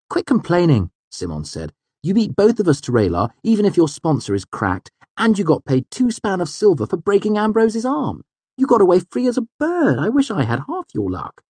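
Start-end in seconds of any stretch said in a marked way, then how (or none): 0.1-0.9 high, loud friend's voice; exasperated friend. A narrator's reading. Friend cuts the complaint short. 2.0-11.4 high, emphatic, envious friend; exasperated friend. A narrator's reading. Friend scolds him for his luck.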